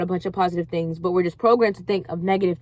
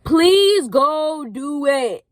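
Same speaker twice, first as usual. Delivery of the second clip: slow, drawn-out, higher plea with each word pressed; urging the viewer, slowly stretching every word; urgent plea; 6 semitones higher, much slower than usual. Real, not acted. To viewers chasing their dreams, a slow pressing plea.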